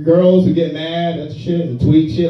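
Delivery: ordinary talk, nothing marked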